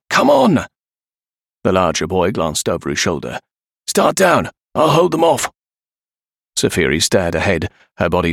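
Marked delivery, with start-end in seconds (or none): 0.1-0.7 high, pressing shout; urgent call. A narrator's reading. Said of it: "Peter called". 3.9-5.5 high, pressing command; urgent shouted order. A narrator's reading. Shouted orders during escape.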